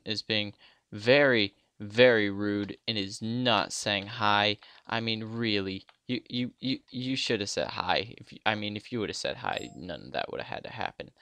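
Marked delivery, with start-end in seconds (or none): none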